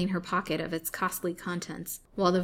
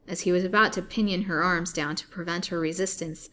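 ordinary delivery, nothing marked

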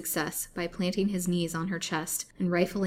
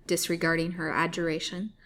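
plain reading voice

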